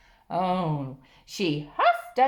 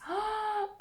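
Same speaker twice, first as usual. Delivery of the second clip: excited gasp; 9 semitones higher, 8 dB quieter than usual. Acted.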